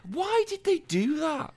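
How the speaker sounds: very high, slow mock whine; drawn-out falsetto of mock bewilderment; mock bewildered squeal. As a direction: squeak out your baffled complaint. Mock complaint about renamed game.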